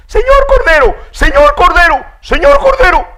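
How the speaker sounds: very high, loud squealing call; a frantic mouse shouting to the lamb; desperate call. Acted. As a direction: scream for the lamb's help.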